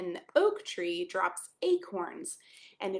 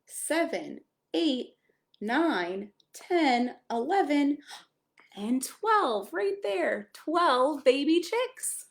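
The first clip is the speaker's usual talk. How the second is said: excited counting; 6 semitones higher, 6 dB louder, much slower than usual. Acted. Counting chicks aloud to child.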